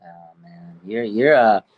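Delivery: ordinary delivery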